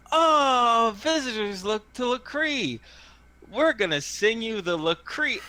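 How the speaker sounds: put-on high, excited little kid; gap-toothed little tyke. Little kid greeting visitors with a song, high child voice. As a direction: pipe up high and loud like a small child. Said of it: "a gap-toothed little tyke".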